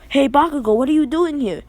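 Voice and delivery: high, surprised call across the beach. Retold or imitated. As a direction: call out to a friend you didn't expect. Deku calls out to Bakugo.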